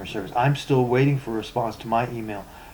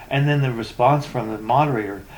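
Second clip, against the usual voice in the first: ordinary delivery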